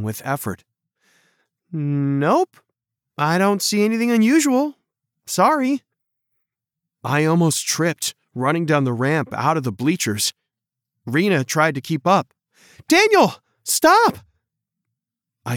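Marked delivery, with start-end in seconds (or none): 1.7-5.8 put-on higher, apologetic girl's voice; a hint of a teenage girl; lightly voiced girl. A narrator's reading. 12.9-14.2 much higher and louder shout; calling out. A narrator's reading. Girl calls after running boy.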